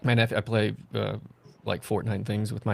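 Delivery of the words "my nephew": ordinary talk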